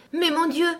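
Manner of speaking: high, alarmed exclamation; startled exclamation. Acted. The fawn noticing the child, startled. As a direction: gasp it in alarm. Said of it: "ajouta-t-il".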